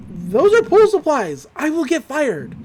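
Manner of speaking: high-pitched mock objection, starting loud and slow; alarmed protest. Brother objects to lending pool supplies, mock alarm. Dipper protests handing over megaphone.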